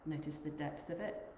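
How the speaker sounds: unhurried meditation guide, long pauses between phrases; guided meditation. Acted. Yoga teacher guiding the group to notice their breath, guided meditation. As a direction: lead them gently inward, leaving long silences.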